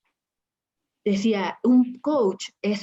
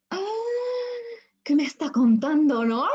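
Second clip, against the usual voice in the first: asombro retomado; 9 semitones higher than usual. Retold or imitated. Asombro al oír la canalización.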